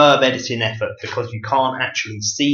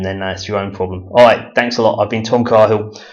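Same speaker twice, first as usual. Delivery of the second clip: normal talking voice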